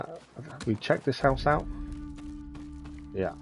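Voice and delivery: ordinary talk, nothing marked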